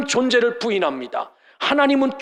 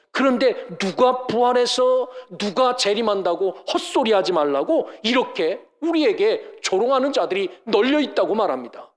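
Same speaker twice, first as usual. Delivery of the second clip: ordinary talk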